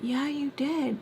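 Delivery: imitated high, hushed insistence; super psycho. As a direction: mimic her unhinged contradiction, softly. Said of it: "super psycho".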